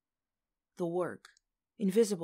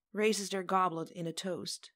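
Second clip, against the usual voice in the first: plain reading voice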